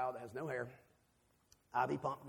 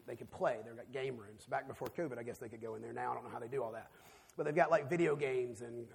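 usual speaking voice

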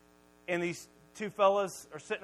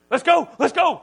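loud, high, hurried-away order; urgent command; 8 semitones higher, 16 dB louder than usual. Retold or imitated. Dad rushing mom out of the hot tub, loud urgency. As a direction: imitate a panicked husband rushing her off.

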